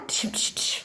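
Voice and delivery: breathy whispered dishoo bursts; whispered gunshot sounds. Pretend. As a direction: whisper the shots in play. Pretend shooting with child. (கிசுகிசுப்பாக துப்பாக்கி ஒலி)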